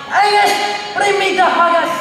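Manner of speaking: high, loud Scottish call; Scottish accent. Acted. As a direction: holler it out in Scottish. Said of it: "Scottish are very manly accent".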